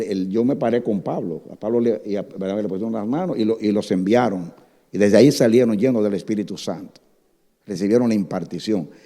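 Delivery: ordinary delivery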